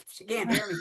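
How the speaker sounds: high, loud laughing words; helpless real laugh through the words. Real, not acted. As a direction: laugh at your own mistake while talking. Laughing at her own shouting.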